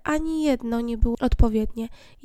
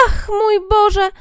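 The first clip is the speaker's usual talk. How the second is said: marvelling cry; 10 semitones higher, 6 dB louder, much slower than usual. Acted. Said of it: "zawołali".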